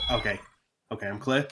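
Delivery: hushed, low muttered all-clear; a hushed mock lookout murmur; quiet self-check. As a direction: softly reassure yourself the hall is empty. Retelling checking hallway while tasking.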